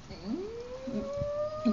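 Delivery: high, soft choked hum; swallowing the lump in her throat. Retold or imitated. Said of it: "un nudo en la garganta".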